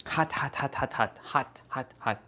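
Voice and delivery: quick soft hot hot hot. Real, not acted. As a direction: hurry the plate down, murmuring. Carrying burning hot plate.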